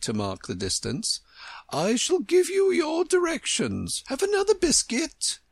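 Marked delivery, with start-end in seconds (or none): 1.7-5.4 very high queen voice; imperious Queen. A narrator's reading. Queen putting in pegs for Alice, high imperious voice. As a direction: announce it high, then offer higher.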